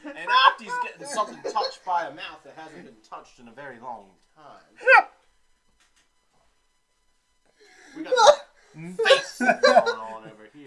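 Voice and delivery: put-on booming old-time radio voice, laughing; hammy vintage newscaster bit; 1930s radio announcer. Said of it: "nineteen thirties radio announcer".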